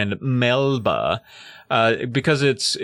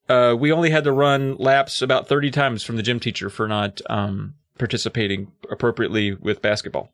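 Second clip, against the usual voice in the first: usual speaking voice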